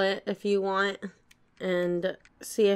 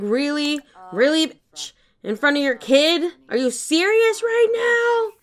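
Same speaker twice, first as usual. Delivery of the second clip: rising to a much higher, louder outraged cry; outraged disbelief; 8 semitones higher, 5 dB louder than usual. Pretend. Daughter confronting mom doing drugs, outraged high-pitched disbelief. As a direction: climb in pitch with each question, furious. Daughter furious at mom's drug use.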